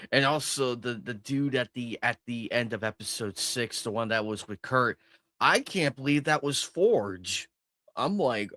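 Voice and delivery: usual speaking voice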